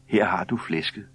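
plain reading voice

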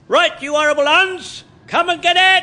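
loud, high challenge yelled at the enemy; battle cry. Acted. Old gunner yells a challenge at the approaching plane, loud battle cry. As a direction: roar it at the sky like a charge.

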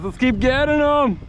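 high-pitched, eager cheer; rally the guys, fired up; happy high holler; excited rallying call. Real, not acted. Cheers on after a catch.

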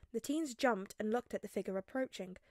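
plain reading voice

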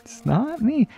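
high, laughing through the words. Real, not acted. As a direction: giggle while admitting it. Laughs at himself.

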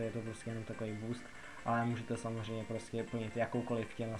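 ordinary talk, nothing marked

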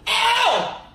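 high, loud sharp ow; pained yelp. Real, not acted. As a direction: cry out in sudden pain. Bit sour candy, ate wrapper.

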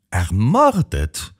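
stammering. A narrator's reading. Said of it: "stammelte Jean".

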